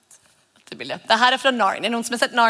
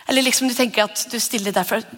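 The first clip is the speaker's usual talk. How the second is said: ordinary delivery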